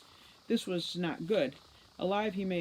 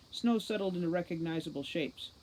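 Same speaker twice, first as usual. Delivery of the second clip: ordinary delivery, nothing marked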